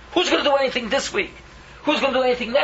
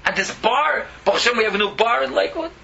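ordinary talk, nothing marked

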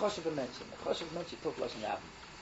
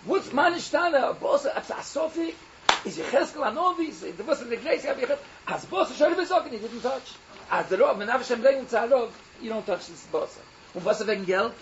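louder, higher sermon climax; rising preaching; 4 semitones higher, 4 dB louder than usual. Acted. Preacher driving home his point to listeners, loud and high. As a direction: build the point with heat.

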